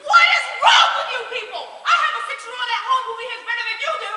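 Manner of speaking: loud, high, fast tirade; furious scolding. Acted. Scolding the bickering guests, a loud furious rant. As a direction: tear into them like naughty children.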